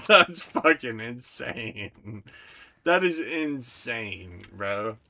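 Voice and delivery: loud, slow, drawn-out exclamation; amazed slow loud call; loud disbelief. Real, not acted. Laughing disbelief.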